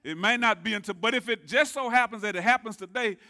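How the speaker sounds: loud, high-pitched preacher cadence; preaching at full force. Real, not acted. Pastor urging the congregation to answer amen, preaching loud. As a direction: swing into full preaching fervor, fishing for an amen.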